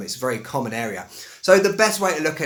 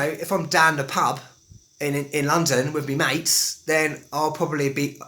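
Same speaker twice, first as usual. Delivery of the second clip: loosened-up London pub accent, "down" as "dahn"; relaxed London accent with "me mates"; broader London accent. Shown as a demonstration. Teacher showing pub talk with mates, broader London accent. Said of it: "speaking a bit more like that".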